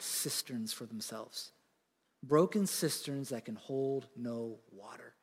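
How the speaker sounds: low, soft, heartbroken plea; grieving God. Acted. Preacher voicing a heartbroken God, low and soft. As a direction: voice God's broken heart, low and hushed.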